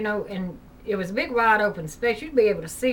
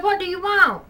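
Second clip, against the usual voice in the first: raised, higher call; calling through a door; 9 semitones higher, 6 dB louder than usual. Retold or imitated. Mother calls to daughter in the shower, higher and louder. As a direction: call out to someone behind a door.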